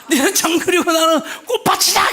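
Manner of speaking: repeated retort, laughing while yelling; wife's retort, half laughing; shout through laughter. Retold or imitated. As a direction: crack up mid-yell. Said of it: "이렇게 나가요".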